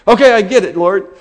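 loud, high cry of giving in; exasperated surrender. Retold or imitated. Rebuked man tells the Lord he gets it, loud exasperation. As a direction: throw it upward, loudly conceding. Cries out to God, conceding rebuke.

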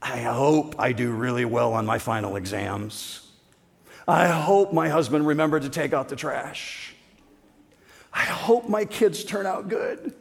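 lightly voiced ordinary folks wishing; a hint of sing-song hoping; mimicking worldly hopes. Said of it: "And it sounds like this".

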